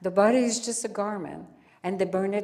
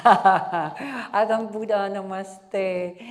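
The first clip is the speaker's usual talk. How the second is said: laughing; 8 dB louder, much slower than usual. Real, not acted. Teasing praise of questioner.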